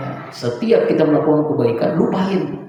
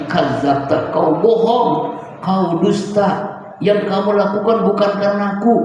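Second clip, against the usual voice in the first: stern divine rebuke; 6 semitones higher than usual. Acted. God rebuking the scholar who sought fame, stern.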